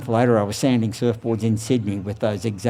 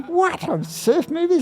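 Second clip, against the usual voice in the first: a surfer hearing the news, high and incredulous; high mock astonishment at surf movie news; surprised question. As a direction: voice jumps way up in disbelief. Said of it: "someone said".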